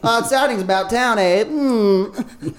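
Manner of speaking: high-pitched cartoon twin voice; identical twin brothers. Host voicing the Bishop brothers to players, cartoon twins. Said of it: "two identical twins are like".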